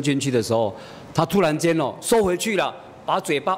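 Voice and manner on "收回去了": ordinary talk, nothing marked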